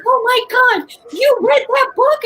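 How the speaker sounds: high, loud, slow "oh my God"; excited. Retold or imitated. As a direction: relive the fangirl thrill. Retelling her own excited reaction.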